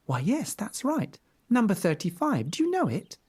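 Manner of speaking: put-on high, bright little girl answer. A narrator's reading. Girl answering the wolf, high and eager. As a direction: chirp it like a small girl.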